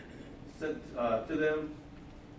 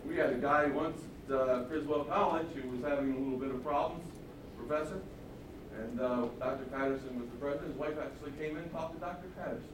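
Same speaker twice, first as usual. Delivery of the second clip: ordinary talk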